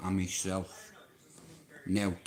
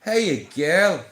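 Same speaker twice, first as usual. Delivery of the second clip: higher, louder sweet call; calling a horse; 7 semitones higher, 10 dB louder than usual. Shown as a demonstration. Man greeting a mare, loud fond call. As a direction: call her in fondly. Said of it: "Like that, look".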